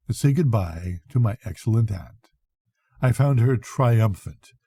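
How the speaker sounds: usual speaking voice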